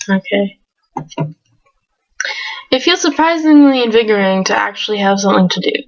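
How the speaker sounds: normal talking voice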